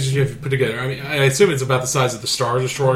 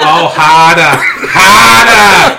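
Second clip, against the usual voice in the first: mock moaning in a Boston accent; hammed-up Boston moan; 4 semitones higher, 11 dB louder, much slower than usual. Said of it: "Boston accent of a woman having an orgasm".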